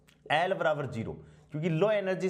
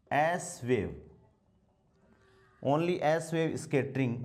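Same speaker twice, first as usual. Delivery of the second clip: each phrase spaced out slowly for students to write down; slow dictation; much slower than usual. Acted. Teacher dictating notes to his class, very slow and spaced.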